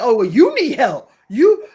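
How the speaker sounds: loud, high comeback in mock indignation; mock offended retort. Acting someone told they need help, mock offended. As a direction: throw it back loud and high.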